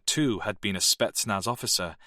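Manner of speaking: plain reading voice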